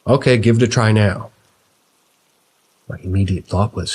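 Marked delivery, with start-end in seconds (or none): 0.1-1.3 put-on loud, thick northern English accent; booming northern accent. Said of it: "a thick northern accent boom out".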